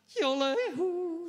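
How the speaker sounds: high, loud playful cheer; gleeful whooping. Real, not acted. Preacher celebrating the listener's New Testament, gleeful whooping.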